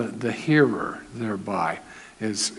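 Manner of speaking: ordinary delivery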